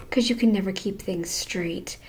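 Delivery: snide whisper. Real, not acted. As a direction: whisper the jab. Mocking aside at the other man.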